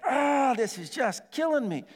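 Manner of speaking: high, slow pained moan. Retold or imitated. Pastor moaning in pain at the gas station, slow and high. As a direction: groan it out like the ear is on fire. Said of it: "I'm like".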